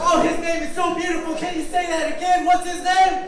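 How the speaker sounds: high, loud, eager storytelling; excited rallying. Real, not acted. Rousing children to repeat name.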